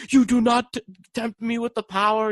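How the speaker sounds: high, booming dark elf-queen put-on; dramatized Galadriel. Imitating the dark elf queen for a friend, over-dramatized.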